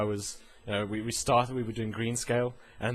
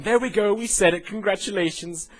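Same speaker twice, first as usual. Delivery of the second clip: high, loud mock congratulations; mock celebration; 9 semitones higher, 6 dB louder than usual. Vlogger congratulating self on a phrase, loud and high. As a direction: announce it like a game-show prize.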